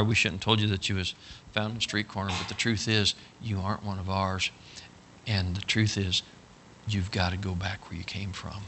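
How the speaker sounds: low, soft, grave confession; mock solemn. Brothers telling Bubba he must go back, mock-solemn. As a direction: feign regret while breaking bad news. Brothers break false bad news.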